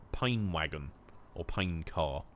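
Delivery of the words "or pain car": normal talking voice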